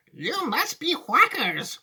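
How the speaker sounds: put-on high, slow old lady; Grandma Josephine. Grandma calling Wonka crazy, high and drawn-out. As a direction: protest in a drawn-out granny wail. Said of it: "Grandma Josephine".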